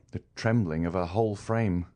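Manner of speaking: ordinary delivery, nothing marked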